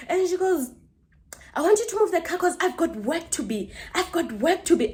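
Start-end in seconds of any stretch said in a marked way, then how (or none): 1.5-4.9 high-pitched imitation of a demand; insistent neighbour. Neighbour who has work to get to, insistent imitation. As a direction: imitate a pleading, insistent complainer.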